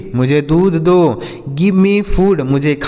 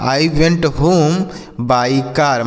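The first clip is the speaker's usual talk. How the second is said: very slow, smooth full sentence; teacher reading the whole line slowly for pupils; much slower than usual. Shown as a demonstration. Teacher repeating the sentence to pupils, very slow. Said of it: "तो बोल सकते हैं".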